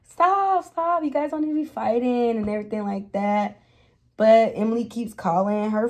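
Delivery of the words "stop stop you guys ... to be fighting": high pleading stop, then settling down; pleading to break up the fight; imitating Nikki pleading. Nikki begging the two girls to stop fighting, high and pleading. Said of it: "Nikki is over there like".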